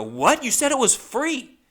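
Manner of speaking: loud and high, incredulous protest. Retold or imitated. Comic raising his voice at the stripper in the club. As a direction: yell it in disbelief at being charged. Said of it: "I'm raising my voice now in the strip club".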